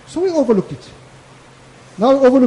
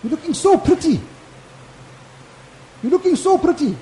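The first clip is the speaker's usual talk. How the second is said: gushing praise; 5 semitones higher than usual. Retold or imitated. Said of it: "as we got a little bit bigger".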